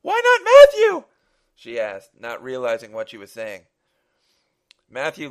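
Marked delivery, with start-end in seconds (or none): 0.0-1.1 piercing high, held scream; frenzied shriek. Acted. Mother offering her son instead, frantic shriek. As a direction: shriek the question at full force.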